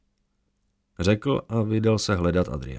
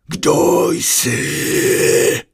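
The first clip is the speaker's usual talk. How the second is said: eerie, breathy voice from nowhere; a presence speaking inside his mind; ghostly unvoiced question, high and loud; mighty voice in his head; 8 semitones higher, 6 dB louder than usual. A narrator's reading. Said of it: "Ozvalo se mu v hlavě tak mocně".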